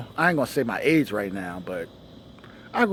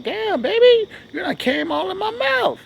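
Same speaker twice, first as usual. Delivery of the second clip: much higher mock woman's voice; imitating a woman. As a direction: squeak it up high like the girlfriend teasing. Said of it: "She be like".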